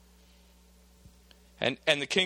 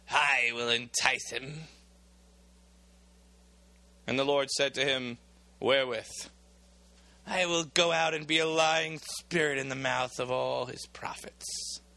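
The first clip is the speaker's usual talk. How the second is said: sly spirit; 3 semitones lower than usual. Acted. A lying spirit speaks to God.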